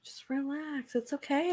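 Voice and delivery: hushed and soothing; calm them down softly. Pretend. Echoing calming whisper.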